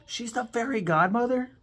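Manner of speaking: high, loud astonished question; shocked question. Real, not acted. Realizing the Queen's actress is also the fairy godmother, astonished. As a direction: gasp it out in disbelief.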